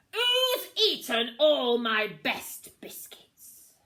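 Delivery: loud, slow indignant demand; angry captain. A narrator's reading. Captain staring into an empty barrel, loud outrage.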